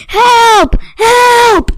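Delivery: high loud panicked scream. A narrator's reading. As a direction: scream for help. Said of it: "she screamed".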